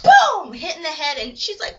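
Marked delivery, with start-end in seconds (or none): 0.0-0.5 very loud, high boom; explosive vocal impact; imitated crash. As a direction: punch out the collision.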